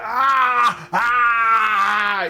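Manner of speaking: loud, high crying yelp; pained ogre howl. Retold or imitated. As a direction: howl as the wrist cracks. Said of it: "he's like".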